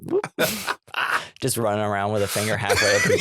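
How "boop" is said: high little popping boop; imitated "boop". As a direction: make a silly high pop noise.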